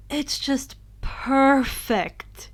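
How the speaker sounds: it's just perfect, stretched slow; drawn-out praise. Real, not acted. Reader praising the story series, drawn out slowly. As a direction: savor every word.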